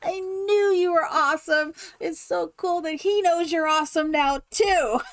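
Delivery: excited cheering. Retold or imitated. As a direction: cheer her on like her biggest fan. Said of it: "It was just like".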